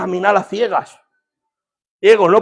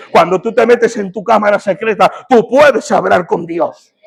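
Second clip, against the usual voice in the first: raised, insistent sermon delivery; full-force preaching; 6 semitones higher, 5 dB louder than usual. Real, not acted. Preacher telling believers they can speak with God, impassioned proclaiming. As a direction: drive each phrase home to the congregation.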